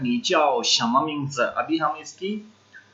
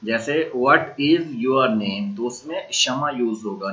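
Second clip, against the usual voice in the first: ordinary talk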